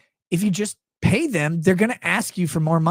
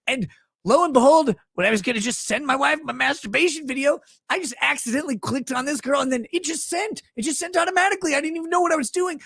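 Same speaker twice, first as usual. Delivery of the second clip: mock innocent husband; 8 semitones higher than usual. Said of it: "He's like".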